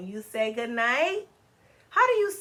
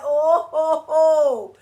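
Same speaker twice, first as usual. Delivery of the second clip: mock crying monkey; 3 semitones higher, 10 dB louder, much slower than usual. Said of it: "One is crying".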